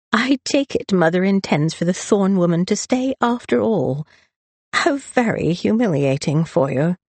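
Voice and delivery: lightly slurred sarcasm; tipsy, slurring brother. A narrator's reading. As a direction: needle her with boozy mock sympathy. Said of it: "the slur in his voice".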